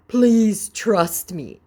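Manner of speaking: slow, drawn-out mock plea. Viewer mocking the scammer, slow and pleading. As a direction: stretch it out slowly to mock him. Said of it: "mocking him".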